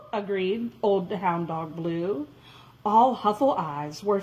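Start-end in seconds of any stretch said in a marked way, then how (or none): none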